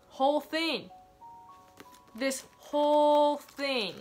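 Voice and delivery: WHOLE held long, high and loud; slow and drawn out on "This whole thing"; stress on WHOLE. Real, not acted. Stressing whole diary filled.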